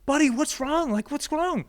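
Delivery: put-on a dad rushing to check on his child; worried dad. Dad reaching his crying son, asking anxiously in a high, urgent voice.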